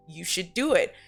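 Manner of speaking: normal talking voice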